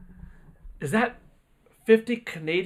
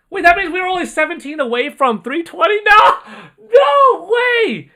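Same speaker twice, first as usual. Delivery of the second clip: excited disbelief; 13 semitones higher, 12 dB louder than usual. Real, not acted. Realizing how close the goal was.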